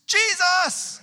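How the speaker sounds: high, loud shout across the street; yelled name. Retold or imitated. Fiancée yelling from the car to get his attention, loud shout. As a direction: call out sharply from the car window. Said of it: "she yells out the window".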